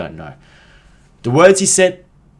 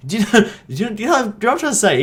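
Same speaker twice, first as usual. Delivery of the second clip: laughing; 12 semitones higher, 5 dB louder, much faster than usual. Real, not acted. Laughing through a repeated question.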